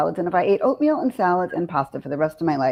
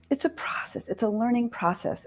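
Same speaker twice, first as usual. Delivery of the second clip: soft reassurance; 4 semitones higher, 7 dB quieter than usual. Real, not acted. Softly reassures about learning.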